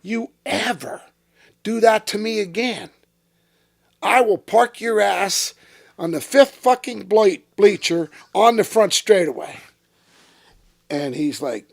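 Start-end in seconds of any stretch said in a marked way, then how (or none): 0.0-9.6 angry threat. Retold or imitated. Threatening Jack in the hauler.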